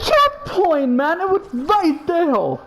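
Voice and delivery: high, loud exclamation; excited outburst. Real, not acted. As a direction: blurt it out at high pitch. Outburst over the story.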